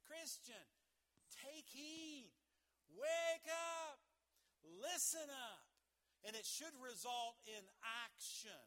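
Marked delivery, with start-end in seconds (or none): 0.1-5.7 building from high call to loud shout; rising to a loud wake-up call; urgent call. Retold or imitated. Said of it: "he's saying".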